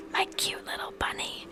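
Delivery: low, flat, raspy whisper; a deep monotone with rasp. Acted. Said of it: "in a deep, monotone voice with a bit of rasp".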